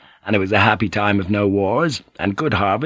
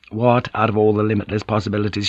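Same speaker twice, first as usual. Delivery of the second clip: normal talking voice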